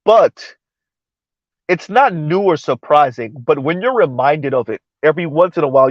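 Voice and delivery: usual speaking voice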